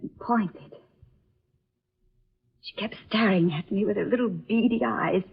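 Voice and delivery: breathy frightened whisper; a low whisper of a frightened girl recalling a nightmare; hushed whisper. Acted. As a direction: whisper it as if the memory still scares you. Girl tells her eerie dream.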